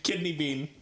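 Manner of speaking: ordinary talk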